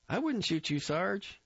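put-on high, eager young recruit; Grossbart voice. A narrator's reading. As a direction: pitch him up, wheedling and innocent.

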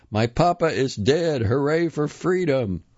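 put-on high, jubilant street-kid cry; urchin shouts. A narrator's reading. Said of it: "urchin shouts".

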